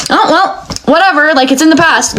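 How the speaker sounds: high, flippant brush-off; mocking dismissive people. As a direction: toss it away in a high careless voice.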